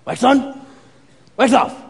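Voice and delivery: Mister Miyagi. Retold or imitated. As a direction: demonstrate it firmly, pausing between moves. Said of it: "And he's like".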